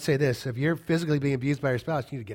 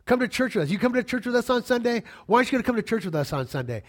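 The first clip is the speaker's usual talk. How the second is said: higher, insistent nagging plea; nagging sister; 7 semitones higher than usual. Retold or imitated. Sister nagging her husband to come to church, higher whiny pleading. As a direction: nag him like a pushy sister. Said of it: "So she would nag my brother-in-law".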